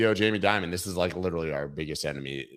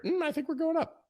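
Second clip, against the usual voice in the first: playful sing-song; 4 dB quieter than usual. Acted. Playful prediction, high mm.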